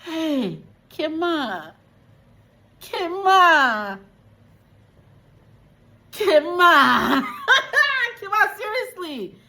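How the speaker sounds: high, loud, laughing calls of her name. Real, not acted. Viewer scolding Kima through laughter, high laughing call. Calling out character.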